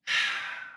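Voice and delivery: long, breathy sigh; heavy sigh. Acted. Reluctant to give up money, heavy sigh. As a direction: let the air out slowly, reluctant. Acts out his own reluctance.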